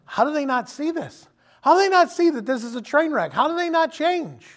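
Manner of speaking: high, pleading disbelief; exasperated disbelief. Retold or imitated. Preacher voicing frustration at stubborn Christians, exasperated. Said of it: "you're going".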